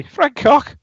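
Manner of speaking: very high squeaky callback; high-pitched accented impression. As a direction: repeat it in a silly high voice. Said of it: "in his high-pitched accent shouts".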